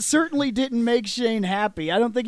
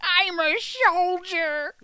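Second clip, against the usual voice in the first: high, slow, laughing soldier line; silly high voice; 8 semitones higher, slower than usual. Pretend. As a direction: squeak it out while cracking up. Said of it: "What the hell happened to your voice?".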